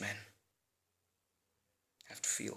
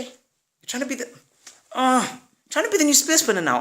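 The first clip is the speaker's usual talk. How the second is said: flustered stammer; 12 semitones higher than usual. Acted. He stammers to his wife.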